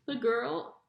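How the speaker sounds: plain reading voice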